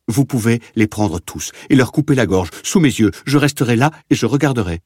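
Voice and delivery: hysterical begging. A narrator's reading. Prisoner bargains away his family to the officer, hysterical begging. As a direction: pour it out in growing panic. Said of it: "cria-t-il".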